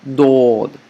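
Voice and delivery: heavy D and open O in Dod; rounded O sound. Shown as a demonstration. Teacher comparing two letters, rounded O in Dod. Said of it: "They have the O sound".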